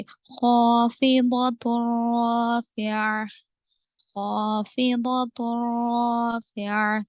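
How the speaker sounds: slow, drawn-out tajweed chant; Quranic recitation. Shown as a demonstration. Tajweed teacher reciting for students, slow measured chant. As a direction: recite the verse slowly with elongated vowels.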